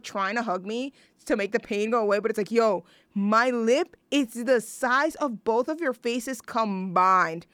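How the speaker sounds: normal talking voice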